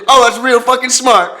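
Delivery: high, loud mock yokel sneer. Sarcastic hick reply.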